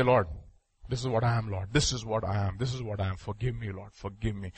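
low, hushed acted confession; subdued, humble admission of guilt; quiet penitent prayer. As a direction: plead softly with the Lord. Voices a sinner owning up to God.

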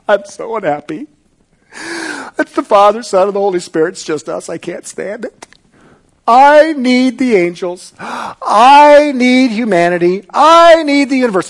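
mock a lonely, needy God whining for company; mock whiny God. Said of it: "He was not in eternity past going".

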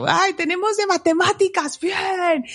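high, gushing cheers; overexcited. Pretend. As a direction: squeal with glee at each subject. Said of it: "que me ponga yo toda emocionada".

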